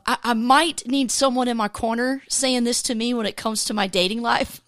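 usual speaking voice

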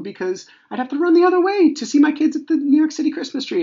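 very high, loud incredulous complaint; exasperated rant. Real, not acted. Dad must run from his kids, exasperated rant. Ranting about fleeing own kids.

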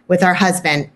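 ordinary talk

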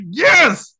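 high, loud cheer of joy; excited shout. Real, not acted. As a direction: yell it like your team scored. Cheering in the cinema.

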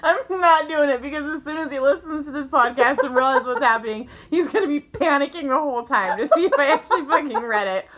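high, loud laughing protest, close to tears; laughing hard. Real, not acted. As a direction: refuse through helpless laughter. Laughing fit over message.

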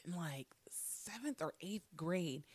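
hushed whisper, leaning in; conspiratorial whisper. Acted. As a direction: tell it like a secret, whispering. Secretive aside, unvoiced.

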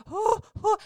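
sudden very high "oh, oh"; excited gasp. Retold or imitated. Wife reliving seeing his tears, a sudden high squeal. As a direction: catch him about to cry, squealing. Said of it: "I was like".

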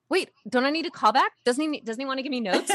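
high-pitched, incredulous questioning; mock bewilderment. Retells own disbelief at sudden offer.

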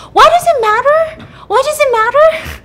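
high-pitched, loud mock shriek; hammed-up panicked yelling; freaking out. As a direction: blow up in a shrill, defensive outburst. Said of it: "she starts freaking out".

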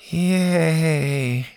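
flat, mock-cheerful yay; deadpan fake cheer; sarcastic. A narrator's reading. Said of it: "Bo said sarcastically".

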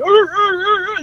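high, repeated seal barking; imitated seal barks. Storyteller playing the seals that chased his crew, imitated barks. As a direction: mimic seals honking in high bursts.

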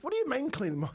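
high-pitched, baffled dad; mock-incredulous comeback to a kid; retold incredulous question. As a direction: act out disbelief, voice shooting up. Dad questioning daughter's frantic demand.

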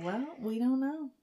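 whisper. Acted. Eerie hushed aside.

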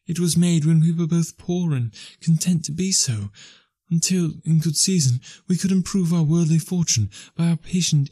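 put-on a fair young girl letting her lover go; young woman's voice. A narrator's reading. Belle, young woman voiced high.

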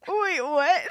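high, loud mock disbelief; startled reaction. James reacting to Dumbledore's request, startled. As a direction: blurt it out as if caught off guard.

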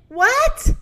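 high, loud startled shout; sudden high-pitched yell. Acted. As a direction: snap back loudly with pitch jumping up. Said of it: "Moo shouted".

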